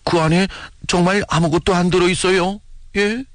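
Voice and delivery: put-on high, insistent young soldier's question; impatient questioning. A narrator's reading. Boy soldier questions his silent commander, high and impatient. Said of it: "참지 못하고 다시 물었다".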